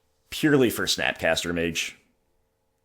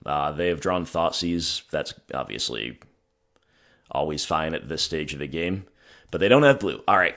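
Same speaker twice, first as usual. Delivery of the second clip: normal talking voice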